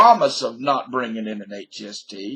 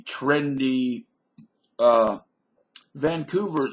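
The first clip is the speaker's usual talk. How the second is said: ordinary delivery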